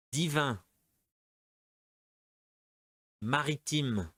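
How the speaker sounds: each word spaced and clearly said for the class; careful teacher model for repeating; model pronunciation. Shown as a demonstration. Teacher giving words for students to repeat aloud. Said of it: "allez, répétez".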